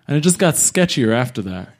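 usual speaking voice

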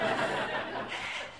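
quiet unvoiced chuckle at own joke; little embarrassed laugh; soft breathy laugh. Real, not acted. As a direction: laugh lightly under the breath. Self-deprecating joke about bills.

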